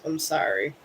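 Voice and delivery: ordinary delivery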